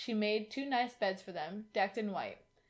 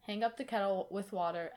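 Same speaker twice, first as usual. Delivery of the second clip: usual speaking voice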